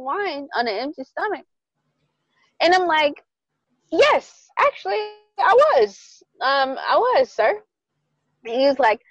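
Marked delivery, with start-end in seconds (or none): 3.9-7.6 sassy retort. Retold or imitated. Sassy reply to angry date.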